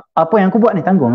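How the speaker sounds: high, insistent retold order; harsh demand. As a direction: imitate someone laying down terms. Acting the man's harsh demand.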